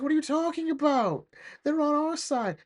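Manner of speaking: high-pitched mock protest; exasperated retort. Partner arguing back at the armed officer, mock exasperated.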